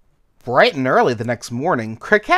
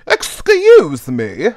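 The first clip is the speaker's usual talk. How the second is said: put-on slow, loud, drawn-out old caterpillar; perplexed, amused caterpillar; 3 semitones higher, 7 dB louder, much slower than usual. Sleepy caterpillar woken by a stumble, perplexed and amused. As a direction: stretch it out, puzzled. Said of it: "perplexed and amused".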